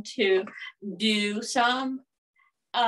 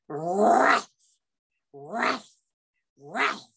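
dog saying his name; 5 dB louder, much slower than usual. Acted. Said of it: "And he said".

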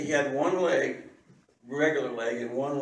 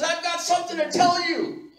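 high, loud announcement to a crowd; excited shouted announcement; calling out; 12 semitones higher, 8 dB louder than usual. Retold or imitated. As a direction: holler the news to everyone around. Beggar announcing to gathered crowd.

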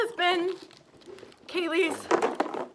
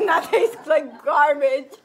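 laughing; 8 semitones higher, 9 dB louder, much slower than usual. Real, not acted. Tasting disgusting candy.

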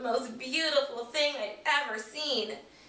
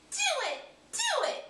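rising, ever higher squeaky cheering; screeched cheer. Acted. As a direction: egg him on, each one higher.

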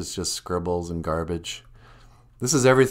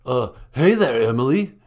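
ordinary talk, nothing marked

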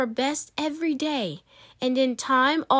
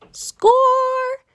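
very high, loud victory cry; victory whoop; triumphant shout; 13 semitones higher, 6 dB louder than usual. A narrator's reading. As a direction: shout it like a goal. Celebrating title won.

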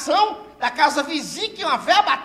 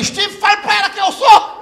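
mock commanding shout; 8 semitones higher, 6 dB louder than usual. Commanding the friend.

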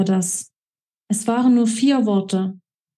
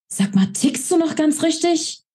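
high, sharp disbelieving snap; pitched-up angry challenge; indignant outburst; 7 semitones higher than usual. A narrator's reading. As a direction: lash out at him in disbelief.

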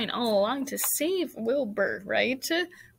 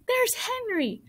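put-on very high girlish call; excited cry; 13 semitones higher, slower than usual. A narrator's reading. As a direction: point out a friend excitedly. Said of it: "cried Fern, pointing".

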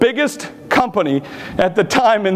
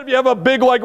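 normal talking voice

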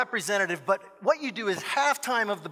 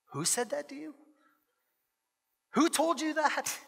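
high, held-back indignant questioning; tightly restrained, pitch pushed up; protective dad; 7 semitones higher, 3 dB quieter than usual. Acted. Dad to upset daughter about the gossip, indignant disbelief.

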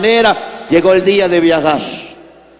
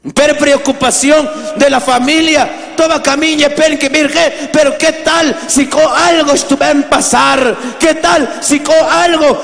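raised, higher preaching voice; impassioned preaching; 7 semitones higher than usual. Acted. Preacher tells the congregation of migrant sons, impassioned preaching.

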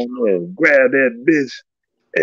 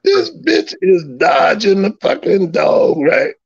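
amazed outcry. Retold or imitated. Watching the match, shouting his amazement to friends. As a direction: cry out in disbelief at the dodging dog. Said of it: "I'm like".

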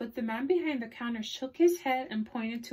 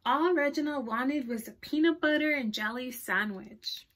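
ordinary delivery

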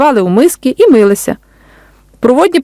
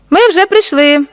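put-on higher-pitched child's announcement; children announcing themselves; 8 semitones higher, slower than usual. A narrator's reading. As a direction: lightly voice a newly arrived young camper. Young campers arriving, voiced by narrator.

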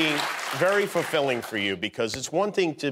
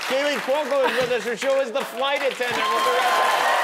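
higher, projected host voice, slowing at the end; talk-show break announcement; 10 semitones higher than usual. Real, not acted. Host sending the show to a break, raised announcer delivery. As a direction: throw to the break over applause.

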